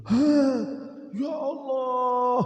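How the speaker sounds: high gasped cry, then long drawn-out awe; hammed-up amazement; acted astonishment. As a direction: stretch it out high, as if stunned. Baker learns guest is his idol.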